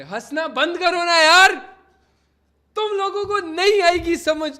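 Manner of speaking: loud mock-indignant rant; loud scolding. Real, not acted. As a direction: tell the chat off loudly. Streamer scolds laughing chat.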